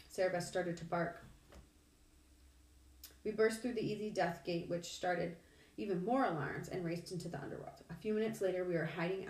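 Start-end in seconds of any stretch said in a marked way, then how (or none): none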